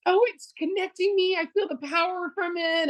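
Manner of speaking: high-pitched, overcome voice of a moved customer; imitated teary awe. Customer crying at a live event, imitated teary awe. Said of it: "at least one person starts crying".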